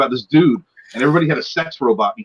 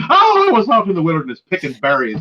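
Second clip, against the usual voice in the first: higher, hammed-up Irish brogue; accidental Irish accent; 6 semitones higher, 4 dB louder than usual. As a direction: put on a silly Irish lilt. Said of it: "I'm doing an Irish accent".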